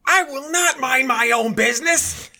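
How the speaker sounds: very high, loud, slow yell; hammed-up indignation, each word dragged out; mock outraged shout. Older shopper refusing to back down, mock outraged shout. Retold man outraged after gasping.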